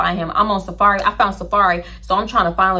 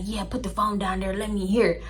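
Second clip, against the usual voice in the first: man on phone; 7 dB quieter than usual. Retold or imitated. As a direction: murmur it like an intimate phone caller. Imitates man on intimate call.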